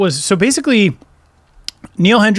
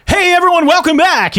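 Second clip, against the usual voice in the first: high, bright hype host opening; announcer voice; 12 semitones higher than usual. Retold or imitated. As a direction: boom out a show intro. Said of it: "there's the announcer voice".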